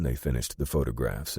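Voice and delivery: ordinary delivery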